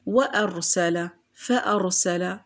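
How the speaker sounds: وأرسل، فأرسل slowly, glottal stop after wa and fa; slow, sound the hamza in وأرسل، فأرسل; hamza pronounced. Shown as a demonstration. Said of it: "لقد نطقنا هذه الهمزة".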